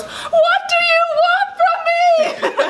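high, slow, laughing mock panic; mock terrified yell. [name] crying out to the banging wall, mock terrified. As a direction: wail it at the wall in hammed-up terror.

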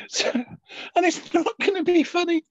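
high, strained attempt at composure; trying to sound serious, nearly laughing; suppressed laughter. Real, not acted. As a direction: fight down the giggles. Composing themselves after laughing fit.

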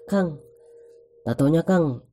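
a hint of a street vendor's call; tattoo seller calling out. Tattoo seller calls out to him.

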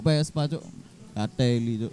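low, soft-spoken swearing; muted, offhand expletive; understated cursing. Real, not acted. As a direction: toss out the curse low and quietly. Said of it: "intonasimu gak intonasi ngono".

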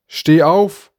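laut und hoch gerufener Befehl; lauter Ruf. A narrator's reading. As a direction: ruf das Mädchen laut auf. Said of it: "und riefst". (lauter, gebietender Ruf)

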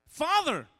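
loud, high "Father,"; calling out. Acted. Younger son calls his father, loud and high. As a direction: call out to him boldly.

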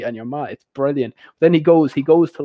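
ordinary delivery

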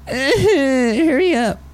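high, drawn-out "hurry up"; mimicked hurry-up call. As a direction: draw it out slowly in a high voice. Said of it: "you guys won't hear the".

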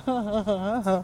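quiet run of laughter; dismissive chuckle. Real, not acted. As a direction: chuckle to yourself after a taunt. Laughs after taunting life.

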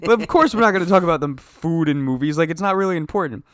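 mock dismissive. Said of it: "I was like".

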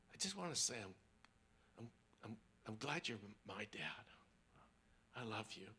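soft, low, stumbling words; a son barely getting it out; halting confession. Retold or imitated. Son telling dad he loves him.

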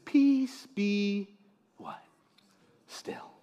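very soft, spaced-out words; hushed command. Acted. Preacher as Jesus speaking to the wind, hushed. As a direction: drop to a near-silent calm.

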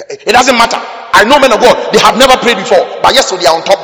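forceful preaching. Real, not acted. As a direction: drive it home hard and high.

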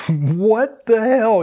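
high, slow, stretched disbelief; incredulous. Retold or imitated. Stylist laughing at the assistant, high drawn-out disbelief. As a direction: drag out mock shock.

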